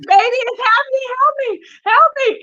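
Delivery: high-pitched re-enacted cry for help; urgent plea. Wife begging her husband to help during an episode, high urgent plea. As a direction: plead in a high, panicky mock wail.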